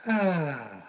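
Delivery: sad voice. Acted. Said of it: "It's my sad voice".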